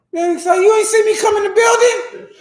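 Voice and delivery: very high, loud hammed-up shout; mock boss shout. Boss yelling at an employee who ignored him. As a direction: yell like a boss scolding at the door.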